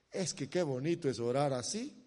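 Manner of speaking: usual speaking voice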